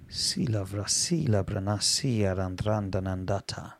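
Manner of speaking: quiet, low praying in tongues; soft low glossolalia; hushed tongues. Real, not acted. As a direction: murmur in tongues to yourself.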